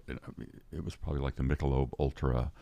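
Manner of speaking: ordinary delivery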